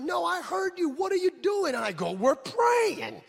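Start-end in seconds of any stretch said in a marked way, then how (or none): 0.0-1.7 high-pitched imitation of an exasperated wife; mock indignant wife, high and insistent; indignant challenge. Wife repeats challenge, escalating. 2.1-3.2 higher, louder mock protest; comically emphatic, raised-voice answer; insistent reply. Insistent second reply to wife.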